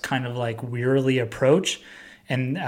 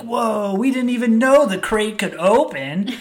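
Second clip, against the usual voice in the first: higher, wide-eyed alien wonder; amazed aliens; 9 semitones higher than usual. Retold or imitated. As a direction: gasp like simple creatures seeing a miracle. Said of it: "And the aliens are like".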